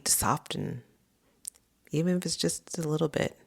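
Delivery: hushed, soothing invitation to let go; guided meditation. Acted. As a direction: gently guide a listener into rest, leaving space between phrases. Leads a rest meditation.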